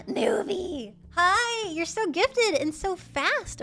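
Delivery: squealing high, loud hello, slowing on the praise; excited greeting. Real, not acted. Streamer greeting her artist Newbie, thrilled and squealy. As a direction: gush over the artist like a thrilled fan.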